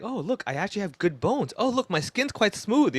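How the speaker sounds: high, mock-delighted discovery; pleasant discovery. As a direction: marvel at yourself in a high, light voice. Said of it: "twenty years later, when you're like".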